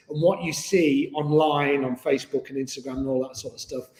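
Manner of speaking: ordinary talk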